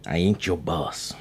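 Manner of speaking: low, slow, sharp New York tough guy; sharp reply. Acted. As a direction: snap it back in a low growl. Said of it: "came the sharp reply".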